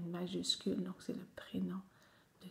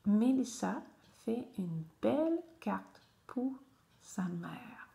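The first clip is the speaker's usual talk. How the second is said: reading aloud; 2 semitones higher, 4 dB louder than usual. Shown as a demonstration. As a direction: read out for beginners with pauses. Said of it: "je te lis le texte".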